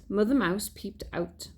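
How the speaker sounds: ordinary delivery, nothing marked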